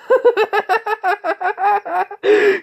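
very high, loud villainous cackle; maniacal laughter. Acted. Jeff at the screaming sludge villain, manic cackling. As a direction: cackle wildly, far above your own pitch. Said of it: "Jeff's just laughing".

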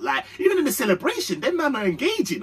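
ordinary talk

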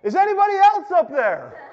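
high, loud call for someone else; shouting up. Acted. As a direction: bellow it upward as a comic punchline. Joke punchline, calling up past God.

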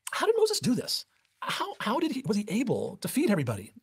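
high, puzzled questioning voice of the rabbis; wondering rabbis. Retold or imitated. Preacher voicing rabbis puzzling over the manna, high and puzzled.

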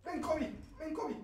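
very soft coaxing call; soft call to cat. Real, not acted. Streamer softly calling his cat Kobe over. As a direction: coax the cat gently.

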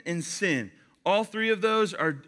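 ordinary talk, nothing marked

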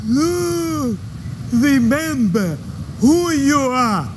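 low, slow, solemn ghostly father; deep slow father voice. Acted. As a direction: intone it like a regal ghost father. Lion King father voice parody.

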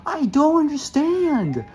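high, loud, slow exasperated rant; frustrated yelling. Real, not acted. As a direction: rage at the game, drawn out. Raging at level design.

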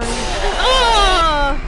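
sudden very loud high scream; startled scream. Real, not acted. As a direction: scream as it jumps out. Jump scare after countdown.

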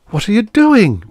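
put-on high, loud girl's protest. A narrator's reading. Girl grabs his arm as he drops the ladder, protesting. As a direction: protest in alarm, voice shooting up. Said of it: "protested the girl".

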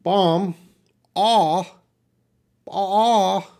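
"Bomb" with an open ah, B silent; ah-sound demo. Shown as a demonstration. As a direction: model "Bomb" as bahm, then a high drawn "ah". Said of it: "the B is silent".

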